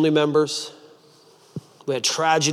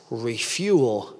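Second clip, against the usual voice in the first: ordinary talk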